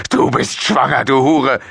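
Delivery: high-pitched furious father; raging. A narrator's reading. Father accusing his pregnant daughter, raging outburst. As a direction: hurl the insult in a rage. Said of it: "tobte José".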